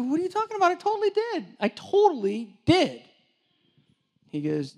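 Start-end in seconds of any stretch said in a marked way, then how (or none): 0.0-3.0 high-pitched, indignant whine of a younger self; high mock indignation, slowing at the end; whiny protest. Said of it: "I go".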